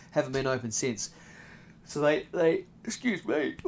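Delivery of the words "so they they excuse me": words through a yawn. Real, not acted. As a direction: talk mid-yawn.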